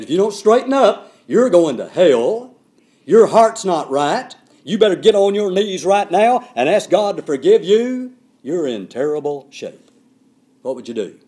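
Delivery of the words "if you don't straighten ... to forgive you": loud, slow hellfire warning; blunt preaching. Shown as a demonstration. Preacher shows his blunt talk, loud and slow. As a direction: hammer the congregation with it. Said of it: "I talk to you like that".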